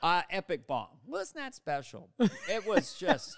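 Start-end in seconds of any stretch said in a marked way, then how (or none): 1.1-2.1 much higher-pitched mock-prim catchphrase. Comic bombing onstage with his bit, high catchphrase voice. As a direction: lift it high, like a worn bit.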